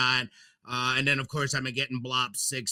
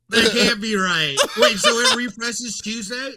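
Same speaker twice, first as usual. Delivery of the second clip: loud, higher-pitched disbelief; alarmed disbelief; 6 semitones higher, 7 dB louder than usual. Real, not acted. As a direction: blurt it out, caught off guard by the news. Just learns the deadline is close.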